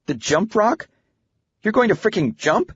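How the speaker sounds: put-on high-pitched, disbelieving girl's questions; incredulous daughter. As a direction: ask in a high voice, scarcely believing dad. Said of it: "recalling her incredulous reaction".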